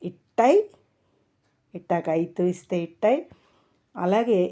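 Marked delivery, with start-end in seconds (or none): none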